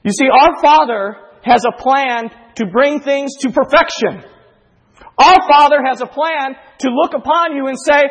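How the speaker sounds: high, loud, climactic preaching; soaring loud sermon climax; preaching at full force. Acted. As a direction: lift the room, preach it out.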